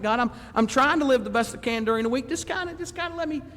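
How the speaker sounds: high, wheedling mock prayer; pleading excuse. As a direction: beg God to leave you alone. Acting a believer begging off.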